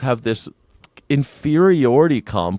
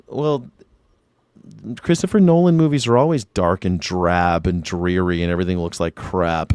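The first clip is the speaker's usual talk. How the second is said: usual speaking voice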